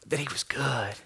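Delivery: whispered. Real, not acted. Pastor recalling God's comfort after his daughter died, whispered. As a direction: breathe it out gently. Tender point in grief story.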